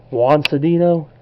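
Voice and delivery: ordinary delivery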